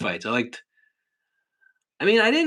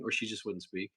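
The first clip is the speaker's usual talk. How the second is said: ordinary talk, nothing marked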